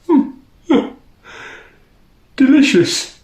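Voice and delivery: high, yawning "Delicious"; queen drifting off mid-word; sleepy yawn. Acted. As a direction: stretch it into a yawn. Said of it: "she yawned".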